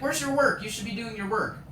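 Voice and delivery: high, loud imitated scolding; mock nagging teacher. Imitates teacher nagging about work.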